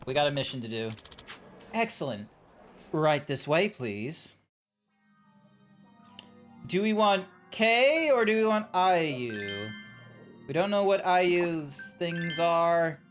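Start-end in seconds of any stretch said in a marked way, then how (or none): none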